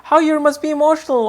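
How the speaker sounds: high, gushing mock voice of people fussing over a Cancer; gushing mock fan. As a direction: coo it up high like a starstruck gossip. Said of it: "people keep telling you".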